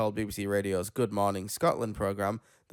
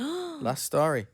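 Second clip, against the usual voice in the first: dramatic announcement; 12 semitones higher, much slower than usual. Acted. Announcing final story dramatically.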